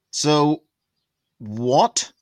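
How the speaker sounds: normal talking voice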